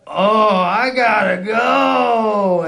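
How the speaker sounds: drawn-out loud panicked bail-out; panicked shout. Retold or imitated. Fleeing the coke line, a slow panicked shout. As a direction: yell it slowly as you flee. Panicked exit from cocaine offer.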